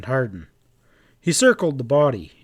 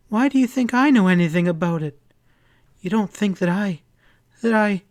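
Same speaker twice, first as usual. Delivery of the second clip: higher, breaking off in horror; horrified faltering; 7 semitones higher than usual. A narrator's reading. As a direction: stumble over the words, frightened. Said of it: "she faltered with a look of horror".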